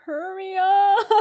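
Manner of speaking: loud, slow, drawn-out whine breaking up at the end; whiny impatience. Real, not acted. Ticket buyer stuck in the queue, impatient wail.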